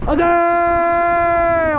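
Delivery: high, long-held cricket appeal; appeal shout. Real, not acted. Fielder appealing to the umpire, drawn-out high shout. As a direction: hold the cry high, pleading for the wicket.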